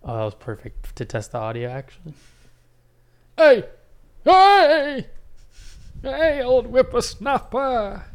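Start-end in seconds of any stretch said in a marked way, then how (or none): 3.4-8.0 put-on high, slow old-timer voice; a crusty old miner hollering; old prospector. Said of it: "Those are vocal warm-ups".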